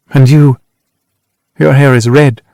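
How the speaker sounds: lightly mimicking his voice; a light teasing imitation of him. A narrator's reading. Annie teasingly mimics the narrator's voice. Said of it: "imitating me".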